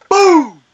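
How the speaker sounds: loud, high imitated boom; shotgun blast. Storyteller recalls the noise that went off, loud boom. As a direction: burst out with a shotgun bang. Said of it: "it sounds like a shotgun".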